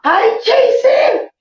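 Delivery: high, slow thrilled squeal of a greeting; excited squeal. Real, not acted. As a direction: shriek hello like a starstruck fan. Fan excitedly greets her favourite.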